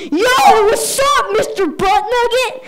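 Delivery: high, loud heckling yell; screaming kid. Retold or imitated. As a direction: holler it like a cheeky six-year-old. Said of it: "Just screaming that on me".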